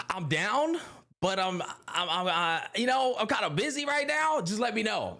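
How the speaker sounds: high-pitched, hedging self-quote; hesitant reply. Retold or imitated. Acting out his reluctant reply to co-host.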